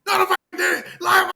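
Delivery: high shrieking mock outrage; mocking angry fan. Mocking a fan yelling back at him. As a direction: squeal it like an offended fan.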